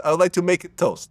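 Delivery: "make it toast" in a light Russian accent. Shown as a demonstration. Showing how Russians put it in the wrong place, light Russian accent. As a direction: stress the stray "it" in "make it toast", Russian style. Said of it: "put an it in the wrong place".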